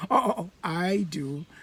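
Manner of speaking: normal talking voice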